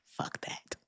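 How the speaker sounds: breathy whispered aside under the breath; hushed whisper, waving it off; whispered curse. Real, not acted. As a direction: whisper it off-mic, dismissive. Muttered aside on stream.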